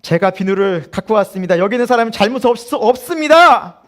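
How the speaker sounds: recruit's shouted report, climbing high at the end; imagined loud confession. Pretend. Recruit owning up to the instructor, mock-brave shout. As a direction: build to a loud military shout, stretching the last word.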